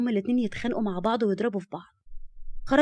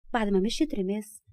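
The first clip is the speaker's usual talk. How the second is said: usual speaking voice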